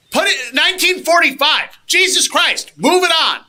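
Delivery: high, loud exasperated rant; fed up, hurry the cashier along. Retold or imitated. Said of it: "And it's like".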